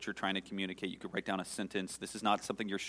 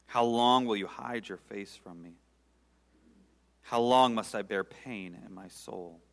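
pleading "how long", louder, then sinking; lamenting psalm reading; 3 dB louder than usual. Real, not acted. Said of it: "read this with my laments in my heart".

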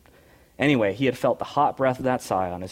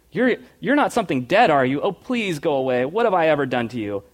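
loud, high, panicked pleading; almost scream it in terror; terrified near scream; 5 semitones higher, 6 dB louder than usual. Acted. Shasta begs the unseen creature in a panicked near scream. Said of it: "he said, almost in a scream".

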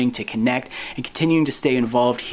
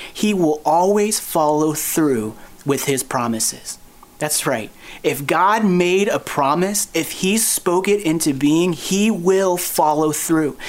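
slow, weighty, every word landed; slow emphatic preaching; 2 semitones higher, slower than usual. Acted. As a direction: lean on each word, slowly, with conviction. Preacher stressing God's faithfulness.

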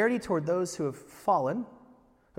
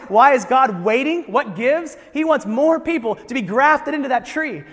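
loud, high, urgent preaching; rising sermon fervor; preaching at full force; 7 semitones higher, 11 dB louder than usual. Acted. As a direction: drive the point home to the crowd.

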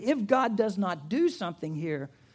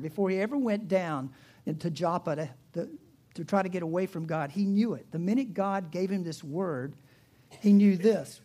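ordinary talk

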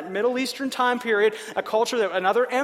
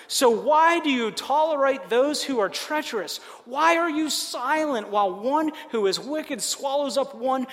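slow, higher, earnest reading of a lament; scripture prayer reading; 4 semitones higher than usual. Acted. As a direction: read it as a pleading prayer to God. Reads Habakkuk's prayer aloud.

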